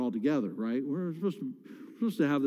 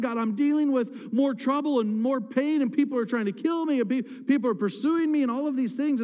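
exasperated complaint; 8 semitones higher than usual. Retold or imitated. Jeremiah complaining to God about his troubles, exasperated and higher. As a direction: pour it out to God, exasperated and rising. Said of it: "He's like".

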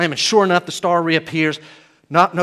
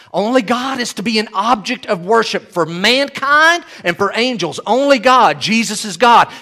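forceful preaching; 5 semitones higher, 6 dB louder than usual. Real, not acted. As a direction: proclaim it to the whole congregation.

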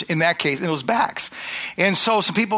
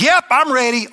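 eager yes; 6 semitones higher, 5 dB louder than usual. Retold or imitated. Eager patient answers preacher.

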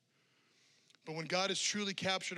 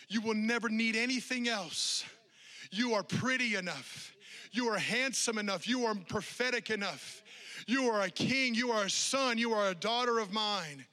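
louder, building declaration of worth; rising declaration; 4 semitones higher, 6 dB louder than usual. Acted. Pastor voicing Jesus's promises to listeners, building loud. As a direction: proclaim each line stronger.

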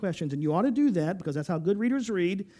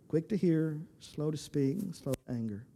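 hushed; 5 semitones lower, 10 dB quieter than usual. Acted. Soft quoted instruction.

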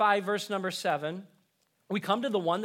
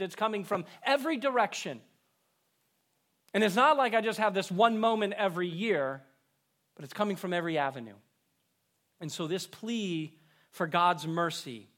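usual speaking voice

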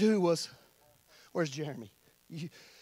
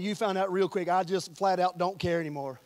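usual speaking voice

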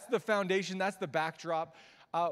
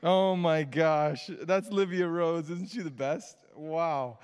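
loud, slow, drawn-out adoration; doting gush; 7 dB louder, slower than usual. Real, not acted. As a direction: savour each word with delight. Gushing after baby daughter's video.

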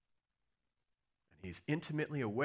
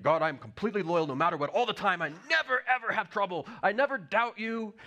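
mock titan of faith; 5 semitones higher, 6 dB louder than usual. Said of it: "some titan of faith".

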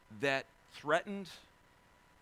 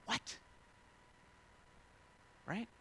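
usual speaking voice

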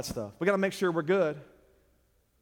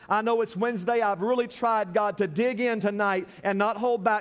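raised, impassioned preacher's prayer; fervent prayer; 6 semitones higher, 4 dB louder than usual. Real, not acted. Pastor asking God to help someone tonight, fervent prayer. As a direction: pray out loud with high, pressing fervor.

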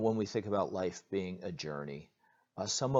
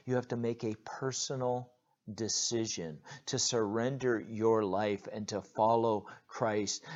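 ordinary delivery

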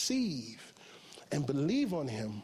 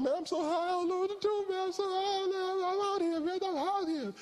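high caller. Retold or imitated. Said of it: "And he said".

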